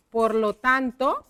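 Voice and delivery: "por lo tanto" drawn out, rising at the end; slow dictation. Shown as a demonstration. Teacher repeats the connector while writing, slow dictation.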